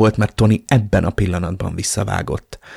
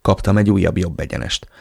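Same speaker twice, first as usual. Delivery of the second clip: plain reading voice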